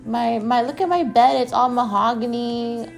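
high, snooty bragging voice; pretentious coworker. Retold or imitated. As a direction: mimic a show-off mom boasting about her house. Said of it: "she was just so pretentious".

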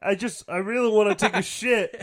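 high, strained frog voice; Kermit the Frog voice. Retold or imitated. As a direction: squeeze it out like Kermit. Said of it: "you gotta say it like him".

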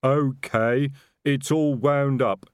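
slow, cheerful troll voice. A narrator's reading. Troll reports his bow is ready, slow and cheerful. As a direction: announce it slowly, like a happy troll. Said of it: "said Detritus cheerfully".

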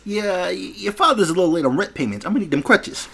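high New Jersey debt collector; New Jersey mobster. Acted. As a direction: shake him down in New Jersey style. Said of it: "stereotypical, like, New Jersey's".

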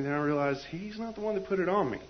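ordinary talk, nothing marked

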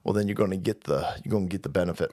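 put-on low, soft mumbling old man; lightly voiced mumbling Biden; Biden impression. Mocking the president's threat, low mumble. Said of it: "he said".

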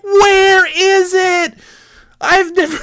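high, long-stretched panicked yell; acted panic. Novice driver hunting for brakes, acted panic. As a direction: drag each word out in a high scream.

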